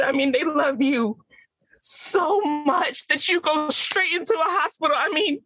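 stage monologue, raw and emotional; dramatic monologue. Acted. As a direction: play a hurt daughter talking to Mama. Performed acting monologue on mic.

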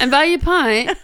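put-on British accent. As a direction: parrot it in a mock British accent. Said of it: "favorite British phrase".

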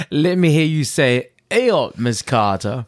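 normal talking voice

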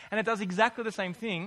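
usual speaking voice

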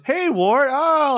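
very high, delighted mock greeting; imitated squeal of welcome; happy group greeting. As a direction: greet him like a thrilled crowd. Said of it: "everyone's, like, happy to see him".

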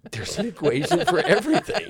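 high, loud words through laughter; real laughing. Real, not acted. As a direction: laugh out the joke. Joking, laughing along with hosts.

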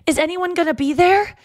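a hint of a fretting friend checking in; quoting a worried friend. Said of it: "she goes".

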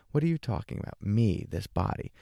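ordinary delivery

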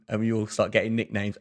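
ordinary talk, nothing marked

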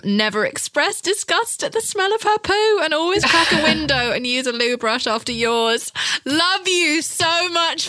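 reading the line through real laughter; can barely read it for laughing; high, laughing read. Real, not acted. Host reading her book's rule, laughing.

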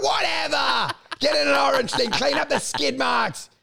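dismissive shout. Retold or imitated. Mock shouting orders at the cleaner. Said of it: "I'm like".

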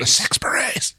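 high, thrilled squeal; excited squeal. Acted. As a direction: squeal it out in delight. Excited call of berets.

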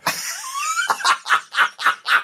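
very high-pitched comic wail; mock wailing. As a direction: let out an exaggerated, piercing cry. Mimics crying over a film.

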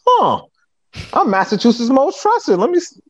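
light up as the idea lands; excited realization. Retold or imitated. Rapper fan claiming his own title, excited.

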